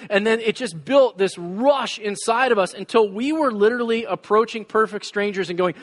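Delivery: ordinary talk, nothing marked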